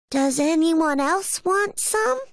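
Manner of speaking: put-on high-pitched young bear character voice; little bear cub. A narrator's reading. Little bear offers food to others, high cub voice.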